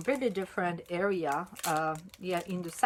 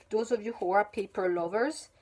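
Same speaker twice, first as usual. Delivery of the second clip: normal talking voice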